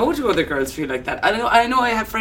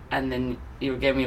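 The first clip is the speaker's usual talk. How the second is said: ordinary talk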